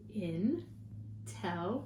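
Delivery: slow, syllable by syllable; split into syllables. Shown as a demonstration. Teacher modelling syllables for the class, slow and chopped. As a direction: chop each syllable out slowly. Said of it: "Let's try intelligent".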